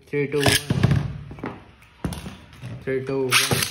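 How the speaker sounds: loud called-out countdown; launch countdown. Real, not acted. Vlogger starting a Beyblade fight, loud countdown.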